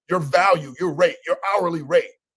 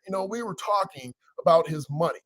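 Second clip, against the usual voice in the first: ordinary talk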